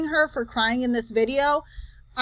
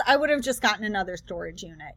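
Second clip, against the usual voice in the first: quick aside; 5 semitones lower, 6 dB quieter, faster than usual. Real, not acted. Restating her point as an aside.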